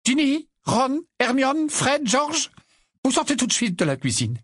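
commanding mother. A narrator's reading. Mother orders children out.

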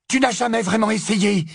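angry Hermione, high and loud; losing her temper. A narrator's reading. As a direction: flare up at a stubborn friend. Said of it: "s'emporta-t-elle".

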